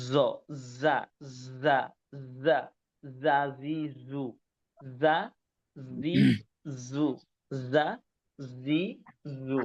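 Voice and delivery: repeated যা, জি, জু as separate syllables, each given its own beat; teacher drilling the Arabic jim syllables for the class. Shown as a demonstration. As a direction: drill the syllables যা জি জু one at a time. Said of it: "এটা হচ্ছে".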